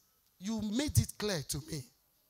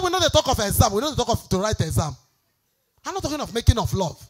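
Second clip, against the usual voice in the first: mock scolding; 8 dB louder, faster than usual. Retold scolding of the couple.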